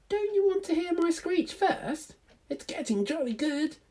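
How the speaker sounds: very high eager owlet voice; high hopeful owl chick; baby owl voice. Acted. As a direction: squeak it up like a keen little owl. Plop begs his mother.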